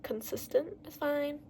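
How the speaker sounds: ordinary talk, nothing marked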